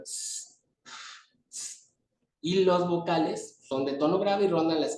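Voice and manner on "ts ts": a whispered, unvoiced ts ts; soft consonant hiss. Shown as a demonstration. Teacher showing students a consonant sound, unvoiced ts hiss. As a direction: hiss ts softly under your breath. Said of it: "por ejemplo".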